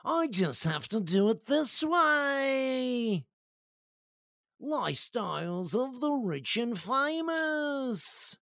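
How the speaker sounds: put-on high, slow, over-the-top British accent; mock British accent, stretched out; hammed-up British accent. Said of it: "that was a pretty good accent".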